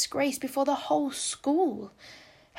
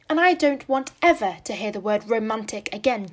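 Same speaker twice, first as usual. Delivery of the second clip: louder indignant scolding; spirited; 9 dB louder than usual. A narrator's reading. Anne scolding Jane Andrews, louder and indignant. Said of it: "momentary return of her old spirit".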